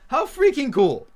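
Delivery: high, loud exclamation; thrilled. Real, not acted. Reacting to a secret fan surprise, thrilled. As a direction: burst out with delighted amazement.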